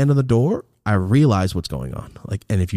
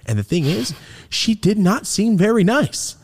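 ordinary talk

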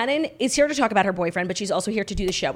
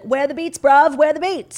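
higher, louder mock complaint; mock British boyfriend; 6 semitones higher, 7 dB louder, slower than usual. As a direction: imitate a British bloke demanding music. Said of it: "he's gonna be like".

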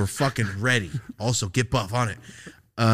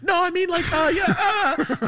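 flustered high voice. Retold or imitated. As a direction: stammer an excuse, pitched way up, laughing. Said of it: "Could you imagine?".

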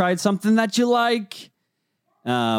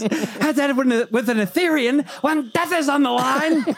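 laughing Vizzini impression; 9 semitones higher than usual. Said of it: "he goes".

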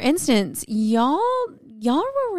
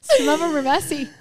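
laughing; 6 semitones higher than usual. Real, not acted. Playful joke repeat.

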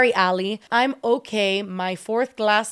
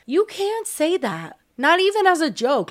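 outraged; 7 semitones higher than usual. Real, not acted. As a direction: scold it in disbelief. Outraged at the message.

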